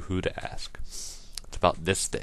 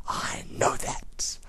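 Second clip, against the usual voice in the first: slow, soft, voiceless; hushed confiding aside; whisper; 4 dB quieter, much slower than usual. Acted. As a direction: lean in and whisper it.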